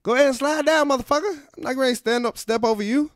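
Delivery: high, loud mock Jackson bossing a lady; Samuel L. Jackson. Comic voicing the actor ordering the pew lady, high and loud. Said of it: "be like".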